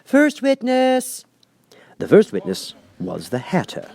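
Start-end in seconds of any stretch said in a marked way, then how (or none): 0.0-1.2 high, slow called-out announcement; calling out. Acted. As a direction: announce across the court. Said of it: "called out".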